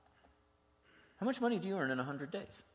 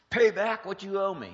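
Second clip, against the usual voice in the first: harsh demand; 3 semitones higher, 10 dB louder than usual. Acted. Harsh master choking his debtor.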